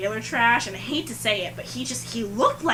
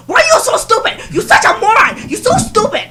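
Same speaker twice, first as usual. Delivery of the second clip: much higher and louder screaming rant; furious boss shout; 11 semitones higher, 12 dB louder than usual. Retold or imitated. Boss screaming at his young waitress, furious shouting. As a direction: blow up at the employee like an enraged boss. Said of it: "super losing his crap on me".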